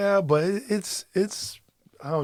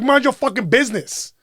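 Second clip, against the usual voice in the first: higher and louder, hostile; angry shout; 9 semitones higher, 5 dB louder than usual. Retold or imitated. Stranger telling husband to mind his business, angry shout. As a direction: spit it back at him. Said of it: "And she's like".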